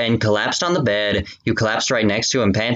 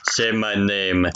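mock lustful; 3 semitones lower, slower than usual. Russia tells the reader, lightly voiced lust. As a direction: breathe it like a cheap romance hero. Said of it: "Russia told you lustfully".

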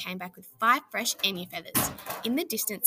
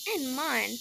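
ordinary delivery

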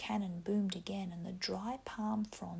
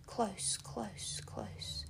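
fading to a whisper; much slower than usual. A narrator's reading. Lord of the Flies draws near.

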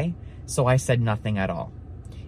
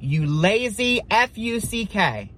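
higher, drawn-out mock yell; retold yell; 7 semitones higher, slower than usual. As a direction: slowly imitate an insult yelled across the street. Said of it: "he yelled across the street".

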